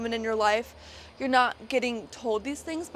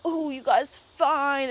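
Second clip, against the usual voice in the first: slow, drawn-out sleazy come-on; mock catcaller; 3 semitones higher, 4 dB louder, much slower than usual. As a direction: mimic a creepy guy leering at two women.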